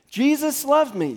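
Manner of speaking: high and loud; said again. Shown as a demonstration. Pastor leading congregation to repeat it, loud. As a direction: keep calling it out. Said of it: "Say it again".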